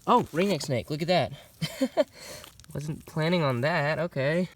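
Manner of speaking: sudden excited discovery; surprised. Real, not acted. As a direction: caught off guard, delighted. Surprise find of a snake.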